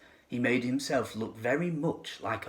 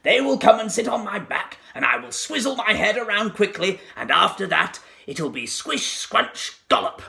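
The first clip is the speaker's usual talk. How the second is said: gloating crocodile; 7 semitones higher, 3 dB louder than usual. A narrator's reading. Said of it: "he said to himself".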